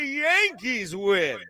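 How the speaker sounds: slow, high stretched call; drawn-out announcer call. Pretend.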